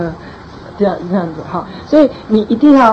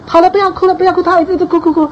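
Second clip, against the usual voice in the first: a hint of a fed-up parent, pitched higher; mock impatient parent; 8 semitones higher, faster than usual. Pastor imitates a parent telling a child to stop crying, higher. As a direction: play the parent losing patience.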